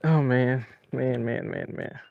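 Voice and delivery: low muttering, fading to a whisper; deflated, trailing into near silence; real dejection. Real, not acted. Dejected after losing the fish.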